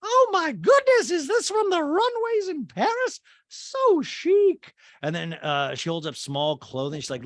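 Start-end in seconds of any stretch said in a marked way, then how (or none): 0.1-4.7 much higher, louder, slow put-on socialite voice; high loud slow caricature of a gushing rich woman; Dorit voice. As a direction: gush it in a high, loud mock-posh voice. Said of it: "And Dorit's like".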